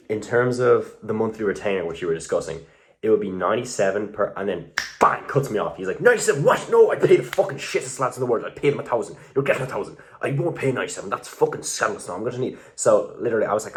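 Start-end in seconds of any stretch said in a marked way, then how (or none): 6.0-12.6 client rant. Retold or imitated. Client cuts him off, ranting.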